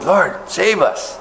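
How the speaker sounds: high, slow pleading cry; imitated cry to God. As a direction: plead for rescue, drawn out. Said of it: "crying out to the Lord".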